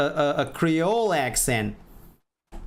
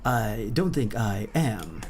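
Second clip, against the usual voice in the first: low, halting hero delivery; mock hero voice; 5 semitones lower than usual. Streamer doubting fitness for the Batman role, low halting hero voice. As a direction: lower the voice, pause like an old TV hero. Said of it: "Why do I speak like frickin'?".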